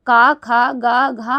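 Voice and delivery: का, खा, गा, घा spelled out slowly one by one; slow letter recital. Shown as a demonstration. Teacher slowly reciting the ka row for students. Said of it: "ये थी".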